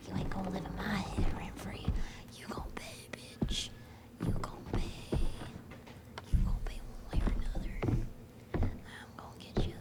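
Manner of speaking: mock-menacing whisper; whispered threat. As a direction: whisper threats under your breath. Whispered threat to a freeloading character.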